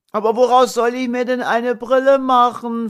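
put-on boy pitifully asking his father; plaintive child. A narrator's reading. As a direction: whimper it like a thwarted five-year-old. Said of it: "fragte Thomas kläglich".

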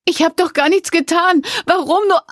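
very high, loud desperate cry; begging in agony while whipped; under torture. A narrator's reading. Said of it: "rief sie".

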